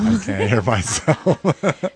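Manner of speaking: laughing. Real, not acted. Laughing about own volume.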